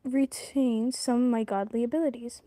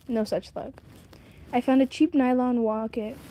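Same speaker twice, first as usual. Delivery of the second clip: usual speaking voice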